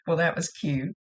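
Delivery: ordinary delivery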